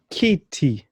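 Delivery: "kitty" with ee vowels, kee-tee; Swahili i vowel. Shown as a demonstration. Teacher modelling Swahili word for chair, ee sounds.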